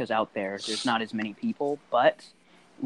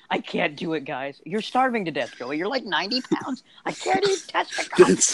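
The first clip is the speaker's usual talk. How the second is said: whiny higher pleading, ending in a laugh; mock Joey pleading; 7 semitones higher than usual. Friends tease Joey starving, a mock whiny plea. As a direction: play a starving friend refusing food. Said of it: "Joey's gonna be like".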